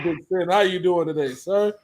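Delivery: ordinary talk, nothing marked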